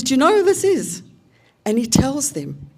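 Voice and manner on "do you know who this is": much higher and louder, bursting with emotion; overwhelmed announcement. Retold or imitated. Partner revealing who she is to the room, emotional outburst. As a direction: cry it out to the whole circle.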